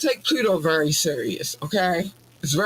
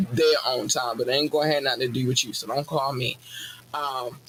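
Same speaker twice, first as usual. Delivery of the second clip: usual speaking voice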